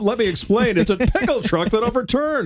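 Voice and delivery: mock news anchor. Mocking co-host's news read.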